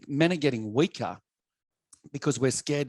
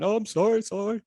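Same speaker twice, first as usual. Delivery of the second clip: high, pleading mock sob; imitating a scared kid crying sorry; a teen's tearful, high-pitched apology; retold crying plea; 9 semitones higher than usual. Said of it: "and I'm crying".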